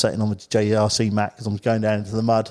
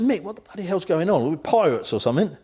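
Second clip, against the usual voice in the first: higher-pitched, retold alarm; bewildered questioning; 7 semitones higher than usual. Angler asking his mate about shouting strangers, mock bewildered. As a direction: replay baffled alarm at your mate.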